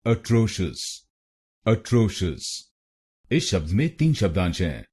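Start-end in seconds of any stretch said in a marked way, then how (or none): 0.1-2.6 atrocious as "अ-ट्रो-शुस"; correct pronunciation. Shown as a demonstration. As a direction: say atrocious cleanly as a-TRO-shus. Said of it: "इसकी सही pronunciation है". (सही उच्चारण)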